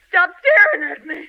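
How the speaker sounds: crying shout. Acted. Wife losing control, crying fearful shout. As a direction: break into a tearful shout.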